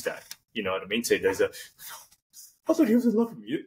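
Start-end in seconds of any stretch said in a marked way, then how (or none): 2.7-3.6 high-pitched, hammed-up heartbreak; mock heartbroken. Actor as a girl let down by a player, mock heartbroken. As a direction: wail it like a jilted lover, playfully. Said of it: "and you get heartbroken".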